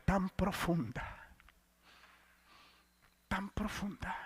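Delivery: breathy whispered echo. Retold or imitated. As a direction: let the phrase fall to a whisper. Describing descent into darkness.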